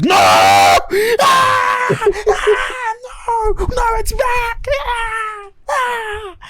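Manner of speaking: high, loud, hammed-up screaming of no and ah; imitating monster screams. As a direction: shriek like the Midnight creature, cry out in terror. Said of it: "a fucking monster from Midnight and goes".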